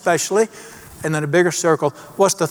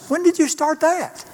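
mock surprise; 12 semitones higher than usual. Teasing God's surprising word.

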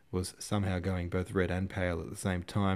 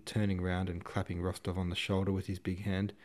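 plain reading voice

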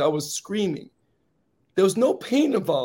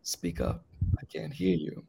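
breathy near-whisper, much softer; 12 semitones lower, 10 dB quieter than usual. Retold or imitated. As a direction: lean in and barely voice it. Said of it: "my friend leans in and he goes".

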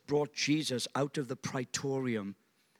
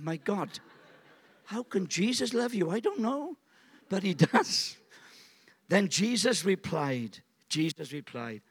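normal talking voice

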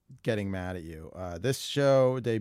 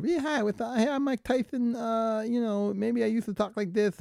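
much higher, squeaky boxer voice; high Tyson impression; 13 semitones higher than usual. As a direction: pitch way up like a squeaky prizefighter. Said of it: "when they do their Tyson impression".

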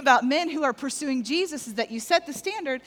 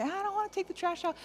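put-on grumbling kid; 7 semitones higher, 6 dB quieter than usual. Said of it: "the kids start to grumble and say".

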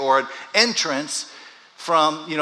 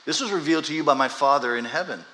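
usual speaking voice

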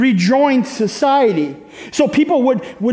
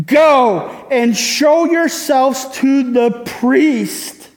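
slow reading; much slower than usual. Shown as a demonstration. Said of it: "listen to Jesus' answer".

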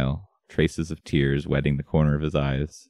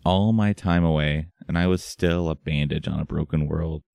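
plain reading voice

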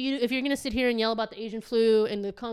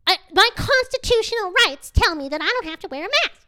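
higher, louder imitation of an outraged customer; mock indignant protest; 8 semitones higher, 5 dB louder than usual. Gym woman refusing the store lady, mock indignant. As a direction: protest in a raised, put-on voice.

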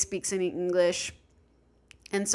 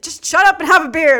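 loud mock shout, raised pitch; exasperated order; 8 semitones higher, 11 dB louder than usual. Friend telling nervous traveler to relax, exasperated mock shout. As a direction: playfully yell to snap her out of it.

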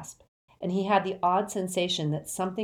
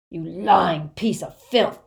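loud, slow, venomous insult; furious, forceful accusation; angry shout; 8 dB louder, slower than usual. A narrator's reading. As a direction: shout it slowly, leaning on each word. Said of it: "shouted the wizard named Selwyn".

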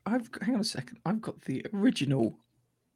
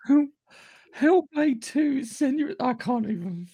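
laughing fit; 12 semitones higher, slower than usual. Real, not acted. Giggling fit trying to present.

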